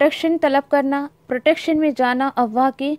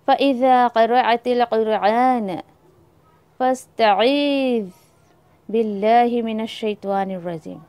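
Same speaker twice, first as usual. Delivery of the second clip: slow, measured recitation of the verse; Quran recitation; 3 semitones lower, slower than usual. Shown as a demonstration. Teacher quoting the verse to students, slow recitation. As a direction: draw out फ़इज़ा क़रअतिल Quran like a qari.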